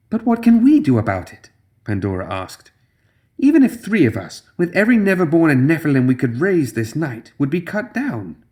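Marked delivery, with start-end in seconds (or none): 0.1-1.5 much higher, lightly voiced doubtful woman; a hint of an anxious young witch; Pandora voice. A narrator's reading. 3.4-8.4 much higher, lightly voiced doubtful woman; a hint of an anxious young witch; Pandora voice. A narrator's reading.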